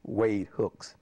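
very slow, spelled-out "My name is Wade Hooks"; slow, deliberate model answer; demonstrated full sentence. Shown as a demonstration. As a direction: draw out "My name is" before the name. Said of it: "he wanted me to say".

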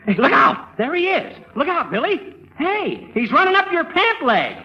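high, excited shouts of alarm; alarmed warning. Acted. Fibber warning Billy the mouse is loose, high alarm. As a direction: yell a frantic warning.

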